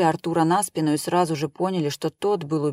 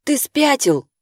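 high, louder alarmed snap; 8 semitones higher, 5 dB louder, slower than usual. A narrator's reading. Wife hisses at husband.

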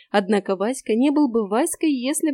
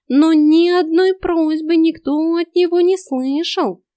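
higher, slow, insistent cat voice; earnest talking cat; 5 semitones higher, 6 dB louder, slower than usual. A narrator's reading. Cat earnestly persuades the girl, slow and high. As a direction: plead with her, deliberately. Cat's long earnest plea.

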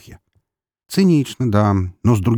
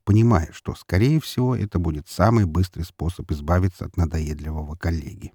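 usual speaking voice

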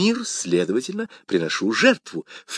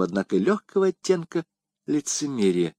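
ordinary delivery

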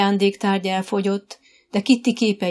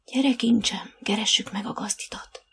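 whisper; 2 semitones higher than usual. A narrator's reading. Dorka whispers to her horse Dóri, a gentle whisper. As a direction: breathe it, no voice at all. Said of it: "suttogta Dorka".

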